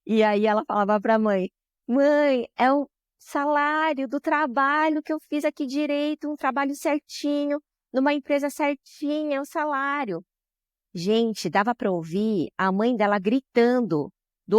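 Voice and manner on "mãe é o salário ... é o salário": raised, emotional voice, near tears; retold tearful quote. Maria telling her mother on the phone about her first paycheck, tearfully high. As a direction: voice lifted high as she tells her mother through tears. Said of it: "Chorando, chorando".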